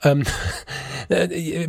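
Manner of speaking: talking around a sip; words between swallows; while sipping a drink. Real, not acted. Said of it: "muss ich kurz einen Schluck trinken".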